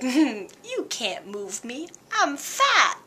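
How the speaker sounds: slow, smug voicing of a fat creature, squeaking up on fat; lazy fat Pokemon voice. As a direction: lazily boast you can't be moved. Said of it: "he's like".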